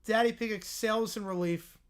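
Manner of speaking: usual speaking voice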